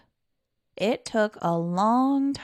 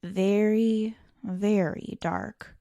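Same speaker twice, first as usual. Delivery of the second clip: plain reading voice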